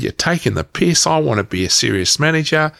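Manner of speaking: high-pitched mock indignation; retold outrage. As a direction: protest like you've just been insulted. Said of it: "And I lost my shit".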